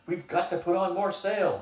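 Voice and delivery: a scared little man begging the captain to flee, high and frantic; piped frantically. Acted. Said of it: "he piped frantically".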